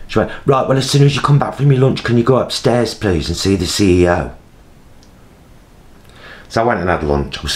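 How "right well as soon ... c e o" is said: raised, quick West Midlands summons; West Midlands accent. Retold or imitated. CEO's sister summons carer upstairs, mock West Midlands accent. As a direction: imitate an officious West Midlands woman. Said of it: "she's West Midlands".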